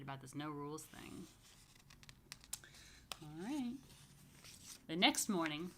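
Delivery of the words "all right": usual speaking voice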